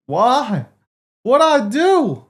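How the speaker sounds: loud high protest, slow on what'd I do; real loud bewilderment; indignant outcry. Real, not acted. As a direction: demand an answer, outraged. Banned, protesting innocence.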